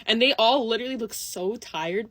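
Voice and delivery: usual speaking voice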